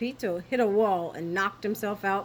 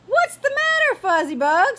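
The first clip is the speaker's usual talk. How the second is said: much higher, louder motherly call; mother's voice; 14 semitones higher, 7 dB louder than usual. A narrator's reading. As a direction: ask her scared son sweetly. Mother comforts son.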